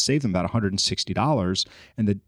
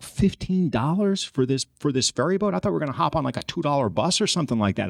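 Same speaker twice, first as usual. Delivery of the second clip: retold fired-up protest; 6 semitones higher than usual. Man griping to his buddy about the ferry fare, indignant. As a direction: protest the price in mounting disbelief. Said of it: "I'm getting really fired up now".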